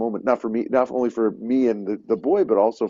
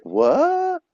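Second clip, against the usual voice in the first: high-pitched baffled "what?"; mock the startled double take of a passerby; imitating someone gobsmacked, pitch shooting way up; shocked reaction. Acts out locals' startled reaction to beard.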